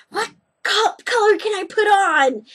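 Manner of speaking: high, loud eager asking; eager question. Retold or imitated. Asking the professor which nail color, high and eager. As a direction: pipe up excitedly, wanting a color. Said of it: "so I'm like".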